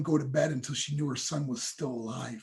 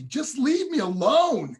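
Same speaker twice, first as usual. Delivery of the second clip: imitating a higher, slow, exasperated teen snap; retold angry outburst; 8 semitones higher, 6 dB louder, slower than usual. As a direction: drag it out like a fed-up kid shutting his mom out. Slamming door on his mom.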